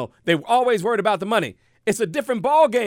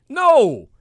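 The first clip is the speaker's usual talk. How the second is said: loud no; 6 semitones higher, 7 dB louder than usual. Real, not acted. Emphatic rejection.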